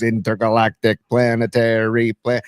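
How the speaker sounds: ordinary delivery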